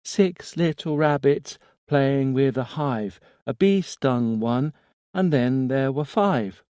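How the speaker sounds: put-on high, slow childlike nursery rhyme; little boy voice. A narrator's reading. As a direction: recite it like a small eerie child. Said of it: "small, infantile voice".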